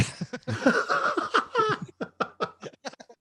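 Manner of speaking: a very high, long burst of laughter; big real laugh. Real, not acted. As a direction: crack up at the memory.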